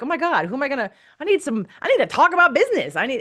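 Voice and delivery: high, urgent mock exasperation; exasperated outburst. Acting out her own pent-up exasperation.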